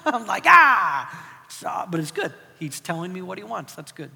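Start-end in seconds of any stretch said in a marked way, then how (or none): 0.5-1.5 a short loud high "Ah!" of mock frustration; joking about being beaten at his own game, a loud mock cry; exasperated yell. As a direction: throw up your hands with a sharp "Ah!".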